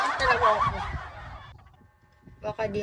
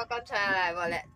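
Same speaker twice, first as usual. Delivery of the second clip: ordinary talk, nothing marked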